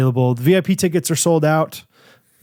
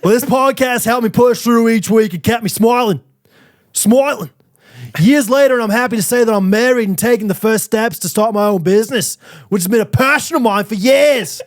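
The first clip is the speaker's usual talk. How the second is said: higher, hammed-up Australian accent; 3 semitones higher than usual. Podcaster reading a fan's review in overdone Australian accent. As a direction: lean into a broad, wobbly Australian accent. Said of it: "It's so Australian".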